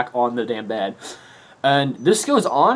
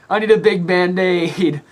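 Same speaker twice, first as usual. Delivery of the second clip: put-on slow, high, louder babyish plea; childish whine; 7 semitones higher, 6 dB louder than usual. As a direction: drag it out like a hurt kid. Ben insisting on bandage.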